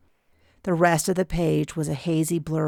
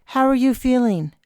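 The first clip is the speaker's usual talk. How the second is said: put-on higher, caring older woman; concerned aunt; 8 semitones higher, 4 dB louder than usual. A narrator's reading. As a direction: ask gently as the aunt. Aunt checks on narrator.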